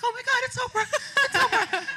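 high starstruck squeal. Retold or imitated. Guest spotting Oprah, high starstruck squeal. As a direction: gasp it out, completely starstruck. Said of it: "And I'm like".

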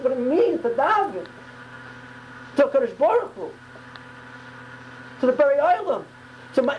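high-pitched, fervent sermon; impassioned preaching. Real, not acted. Preacher warning listeners against praying by rote, impassioned.

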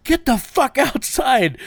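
high, drawn-out retold yell; hammed-up order to clear out; exasperated order. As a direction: imitate yourself yelling someone out. Retelling himself ordering buddy out.

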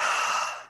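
audible demo exhale; loud exhale. Shown as a demonstration. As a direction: blow the breath out through the mouth. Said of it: "exhale through the mouth".

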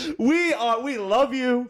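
high sing-song voice. Pretend.

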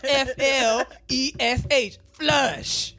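loud, slow spelling, then an emphatic "Flush"; mock-indignant, letter by letter; insistent mispronouncer. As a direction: imitate him spelling it out stubbornly. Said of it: "he'd be like".

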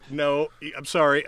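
ordinary talk, nothing marked

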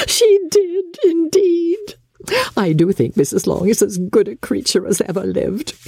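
mother tickled by the compliment, laughing; laughing delight. Acted. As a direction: gloat with a giggle. Mrs Bennet delighted, laughing.

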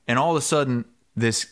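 ordinary delivery

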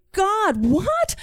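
high-pitched disbelief; acted shock. Child reacting to Dad's gum-selling scheme, shocked. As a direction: shoot the question upward, incredulous.

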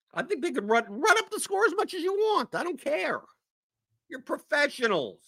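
high, fired-up rant; worked up. Real, not acted. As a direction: rant like you've had enough. Heated rant about professionals.

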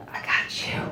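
breathy whisper through a laugh; a hushed, amused I got you; whispered quote. Retold or imitated. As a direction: whisper it like a cool promise. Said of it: "And he was just like".

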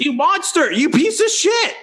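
very high shrill insult; mock fury. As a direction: shriek the insult in mock rage. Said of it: "And I'm like".

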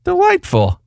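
very high, delighted; excited. Real, not acted. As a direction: sing out delight. Reacting to invitation.

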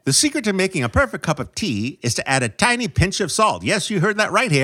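high-pitched vintage movie newsman; 1940s news voice. Acted. Co-host reveals a tea secret, 1940s news voice. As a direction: rattle it off like an old Hollywood reporter. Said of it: "like the nineteen forties news voice?".

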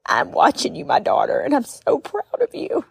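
lightly voiced loving mother; tearful mother's voice. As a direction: speak as her late mother, tenderly. Mother's voice from heaven.

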